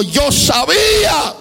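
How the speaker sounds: high tearful cry, slowed. Retold or imitated. Preacher retelling the crying young woman, high tearful voice. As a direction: voice the girl weeping out her words. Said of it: "comenzó a llorar y me dijo".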